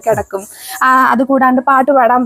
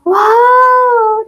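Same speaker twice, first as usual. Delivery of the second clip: amazed praise; 9 semitones higher than usual. Real, not acted. Mother amazed as toddler finds the brush, delighted. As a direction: stretch out a high, impressed cheer.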